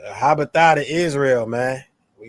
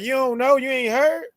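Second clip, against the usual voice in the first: higher teasing disbelief; mock incredulous; 9 semitones higher than usual. Echoing scripture as slang to co-host, lightly mocking tone. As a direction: poke at them playfully. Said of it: "thinking that tone".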